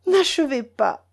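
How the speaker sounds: high, pleading cry; cried out. A narrator's reading. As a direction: cry out to stop him. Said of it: "s'écria Mélanie".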